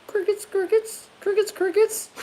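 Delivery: very high squeaky chirping crickets; cricket chirps. Pretend. Joking about no replies from his viewers, squeaky chirping.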